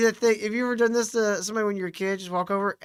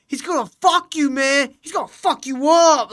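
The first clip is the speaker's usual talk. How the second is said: higher, louder mock taunt; higher, louder retold taunt; 6 semitones higher, 7 dB louder than usual. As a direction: egg on the fight. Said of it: "it's like".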